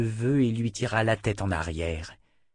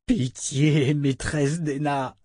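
higher, drawn-out tearful begging; acted sob, pitched up and slow; sobbing plea; 5 semitones higher, slower than usual. A narrator's reading. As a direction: beg as if sobbing, stretching the words. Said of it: "sanglota-t-il".

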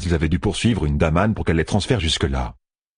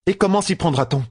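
voix de femme sceptique; 12 semitones higher than usual. A narrator's reading. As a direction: question sceptique, voix plus haute. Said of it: "demanda la chair d'Amerlin". (voix d'Amyrlin sceptique)

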